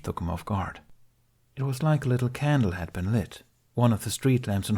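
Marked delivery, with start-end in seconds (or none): none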